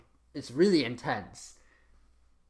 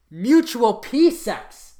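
slow loud read; 5 semitones higher, 7 dB louder, slower than usual. Acted. Hammed reading of title.

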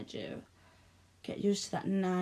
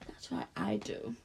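whisper; 14 semitones lower, 6 dB quieter than usual. Acted. Possessive reply whispered.

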